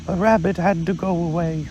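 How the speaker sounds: Pooh, a little vague; mild bear voice, unhurried; lightly voiced Pooh bear. Pooh speaks to Kanga.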